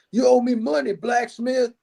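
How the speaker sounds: hammed-up Old West bully; imitating Western outlaw. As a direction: put on a cowboy villain's Southern twang. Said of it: "where he's like".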